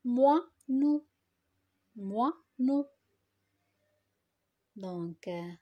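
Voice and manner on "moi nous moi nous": "Moi, nous" as two separate syllables; split into syllables. Shown as a demonstration. Teacher helps pupils find the syllable, "Moi, nous" split in two. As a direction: break "moineau" after moi, each part distinct. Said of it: "dans la première syllabe".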